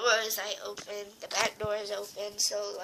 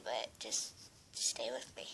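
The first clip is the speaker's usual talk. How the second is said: whisper; 12 semitones lower, 9 dB quieter than usual. Real, not acted. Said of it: "I'm gonna cry a little bit".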